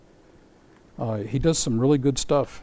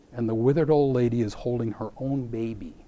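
usual speaking voice

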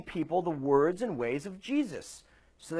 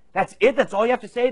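loud, high-pitched disbelief; acting out an affronted bride; indignant; 8 semitones higher, 9 dB louder than usual. Soon-to-be wife challenges the groom's vows, indignant. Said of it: "soon-to-be wife is indignant".

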